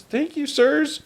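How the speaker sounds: put-on very high, slow little-girl voice; young girl. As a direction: thank them slowly as a small child. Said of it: "she goes".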